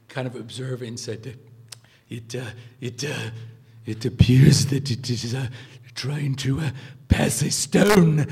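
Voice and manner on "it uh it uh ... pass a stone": throaty voice with stammering pauses, building to a loud finish; halting, effortful professor imitation, swelling very loud on the punchline; Kubler impression. Student imitating his old professor observing a lunging Fiat, throaty, stammering, building loud. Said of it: "gotta get my Kubler voice back".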